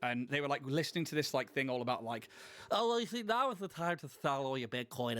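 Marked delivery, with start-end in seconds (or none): 2.7-5.2 imitating a nasal American crypto bro, pitched up at the start; mock American Bitcoin podcaster voice; nasal American accent. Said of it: "this really nasal kind of, like, American accent".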